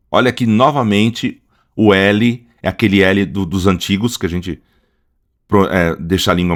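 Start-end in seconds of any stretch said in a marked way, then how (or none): none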